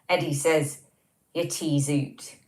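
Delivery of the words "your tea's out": slow reading in an English accent; unadorned English accent, drawn out. Shown as a demonstration. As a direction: read the Scots words in an English accent. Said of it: "That sounds awful in an English accent".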